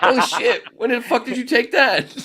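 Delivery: loud, high, laughing shock; laughing surprise. Retold or imitated. Seeing the candid photo, loud laughing surprise. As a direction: blurt it out amazed.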